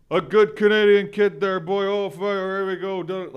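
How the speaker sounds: high, loud hammed-up Canadian kid. Mocking a Canadian kid, high and loud hammed-up voice. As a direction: play up the hoser Canadian kid.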